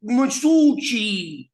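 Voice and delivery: slow, high, New York Masucci, drawn out; a hammed-up New York Italian Masucci; New York accent. Shown as a demonstration. Podcast host shows off a New York accent on the name. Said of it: "with a New York accent".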